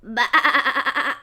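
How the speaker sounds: shouted bleat; loud sheep bleat. Acted. Sheep voiced in story.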